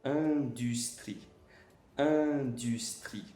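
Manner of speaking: "Indústria" slow, nasal an with a smile; slow pronunciation demo. Shown as a demonstration. Teacher modelling the nasal sound to students. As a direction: stretch out "Indústria" for learners. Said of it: "É como se fosse um an com sorriso".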